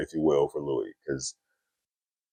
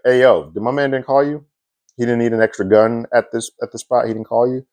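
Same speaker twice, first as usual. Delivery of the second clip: confronting quote; 6 semitones higher than usual. Retold or imitated. Louis confronting Buckley at funeral.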